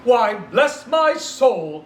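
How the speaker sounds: high, slow astonished exclamation; astonished cry. Acted. Fred astonished to see his uncle, crying out. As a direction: stretch out his surprise. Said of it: "cried Fred".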